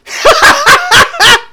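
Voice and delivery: high, loud mock burst of laughter. Boss laughing at the husband, high and loud. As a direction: boss cracking up.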